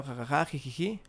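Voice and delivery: soft imitated laugh turning to giggle; mock giggling. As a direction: fake a quiet ha-ha into hee-hee. Acted giggling at the video.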